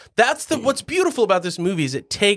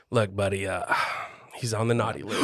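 low, quiet voice breaking bad news; Santa; 10 semitones lower, 8 dB quieter than usual. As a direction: break it to him softly and deep. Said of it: "when, like, Santa's like".

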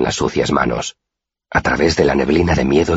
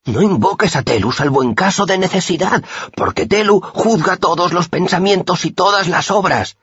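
high, measured recitation of a rule; recited scripture. A narrator's reading. The pious boy reciting doctrine to his friend, high and measured. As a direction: chant it like a learned prayer. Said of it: "recitó".

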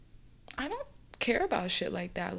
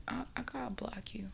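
a soft whisper, much quieter; whispered resolve; 13 dB quieter than usual. Retold or imitated. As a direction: quietly announce you're done with him. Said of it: "I was just like".

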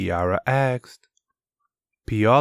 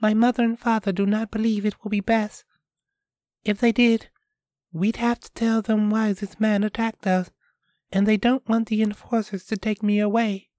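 put-on higher, quick young girl; a girl explaining her parents' fears, higher voice; 13 semitones higher, much faster than usual. Girl Piola voiced in story.

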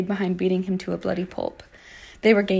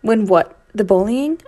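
loud, spitting retort; spat; 8 dB louder, slower than usual. Acted. Narrator hitting back at Archie, a loud spat retort. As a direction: spit the words back at him. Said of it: "I spat".

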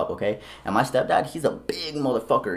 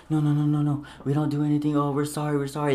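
higher-pitched frantic backing down; mock panicked begging; 5 semitones higher than usual. Outnumbered men apologizing to the family, mock panicked. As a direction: imitate frightened men hurriedly apologizing. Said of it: "they were shitting their pants".

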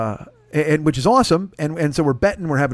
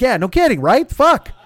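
louder, higher burst; exasperated agreement; 8 semitones higher, 5 dB louder, slower than usual. Retold or imitated. Comic agrees with Pardo, louder exasperated burst. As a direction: blow up in agreement.

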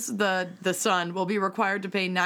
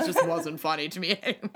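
laughing; 3 semitones higher, faster than usual. Real, not acted. Laughing at the pun.

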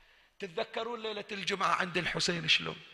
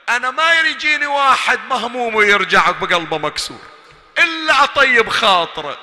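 building to a loud, higher plea; Husayn's impassioned lament; 5 semitones higher, 12 dB louder than usual. Acted. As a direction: voice the imam pleading, rising to a cry. يتكلم بلسان الحسين. (رثاء بصوت الإمام يتصاعد إلى صيحة)

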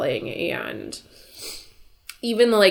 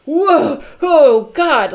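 much higher and louder, stunned gasp; shocked reaction; 11 semitones higher, 10 dB louder, much slower than usual. Retold or imitated. Viewer reeling from the father's insult, loud shock. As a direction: react as if slapped by the words.

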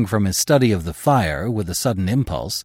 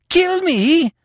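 terrified disbelief; 6 dB louder than usual. Acted.

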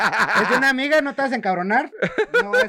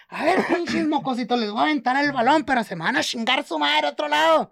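mock angry; 7 semitones higher than usual. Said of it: "se levantó encabronada".

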